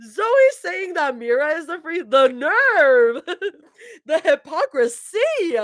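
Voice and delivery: high-pitched, laughing indignation; laughing mock outrage. Real, not acted. Reader scolds the fictional Zoe, laughing in mock outrage. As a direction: exclaim high through real laughter.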